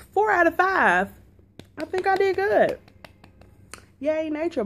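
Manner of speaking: high, loud and proud; excited. Real, not acted. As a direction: cheer your own success. Proud of her ranking.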